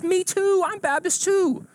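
very high, loud, drawn-out joy; hammed-up squeal of delight; overjoyed agreement. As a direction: burst out thrilled at the match. Joke: growing delight at match.

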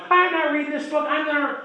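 high-pitched mock outrage at a friend; indignant outburst. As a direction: blurt it out like a hothead refusing to listen. Retold refusal during the argument.

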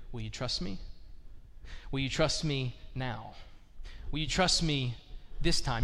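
low, soft, earnest question; gentle appeal. Acted. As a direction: ask it quietly, pausing to let it land. God gently asks for trust.

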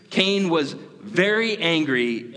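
"very" lifted high and drawn out, slowly; stress on very. Shown as a demonstration. Preacher correcting his own reading, leaning on "very". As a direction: lean hard into "very" and slow down. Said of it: "to say Cain was angry would not be an accurate statement".

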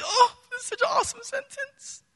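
high, delighted sigh over a love letter; delighted gush. Retold or imitated. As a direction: gush over the sentence like a lover.

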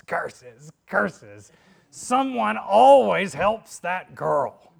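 loud, slow snarling witch; furious witch, drawn out; Wicked Witch voice. Acted. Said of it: "the Wicked Witch says".

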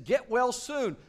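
mock-sweet scolding; mocking teachers. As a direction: parody the legalistic teachers. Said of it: "they're gonna be saying".

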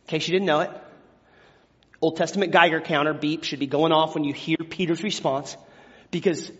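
normal talking voice

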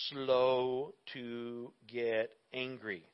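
very slow, each word stretched; drawn out slowly. Real, not acted. Preacher reading scripture to the congregation, slowly drawn out.

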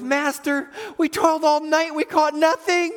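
high, protesting plea; reluctant Peter. Retold or imitated. Peter tries to talk Jesus out of fishing, high reluctant protest. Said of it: "you can tell in Peter's voice".

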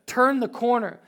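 high, loud, stretched-out preacher shout; impassioned shouting from the pulpit; full-force preaching. Real, not acted. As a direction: cry it out to rouse the congregation.

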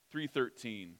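ordinary talk, nothing marked

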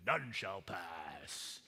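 low, slow mock troll growl; bridge troll. Preacher voicing a bridge troll for the kids, slow and low. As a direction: drag it out like a troll blocking a bridge. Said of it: "They all sound like that".

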